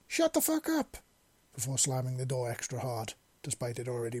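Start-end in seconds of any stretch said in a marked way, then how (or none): 0.1-1.0 much higher furious yell; yelling back. Acted. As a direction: shriek it back through the door. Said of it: "she yelled back".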